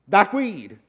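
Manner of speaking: a loud, startled blurt; stunned blurt. Retold or imitated. Young guest shocked at the weed pile, loud startled blurt. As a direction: blurt it out, thrown. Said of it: "And I go".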